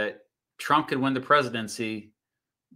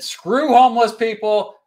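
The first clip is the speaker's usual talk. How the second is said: much higher and louder mock sneer; mock blunt quote; 9 semitones higher, 8 dB louder than usual. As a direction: blurt it out as a cartoon villain court.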